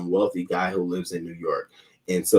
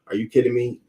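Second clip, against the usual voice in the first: normal talking voice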